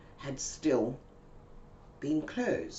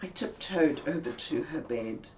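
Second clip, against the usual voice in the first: normal talking voice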